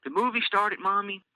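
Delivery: put-on high child voice cutting in; interrupting little girl. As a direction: cut mom off like an eager girl. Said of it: "as Elise interrupts".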